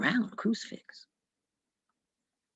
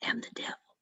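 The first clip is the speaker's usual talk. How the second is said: devil's whisper; 6 semitones lower, 5 dB quieter than usual. Acted. The devil tells Jack in the pub his time is up, in a low whisper. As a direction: breathe it like the devil come to collect.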